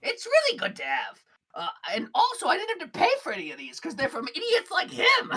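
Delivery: high, loud gleeful brag; boasting shopkeeper. Acted. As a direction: brag about free weapons. Gleb boasts.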